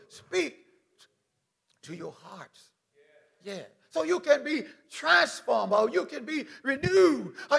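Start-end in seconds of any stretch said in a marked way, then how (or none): none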